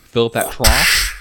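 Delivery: slow loud call to the stable hands. Pretend. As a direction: call it out slowly and loudly. Horse stable joke, loud.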